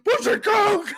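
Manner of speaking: very high, loud mock scream; mock scream at a stranger. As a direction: yell it like a madman. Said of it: "you just start screaming".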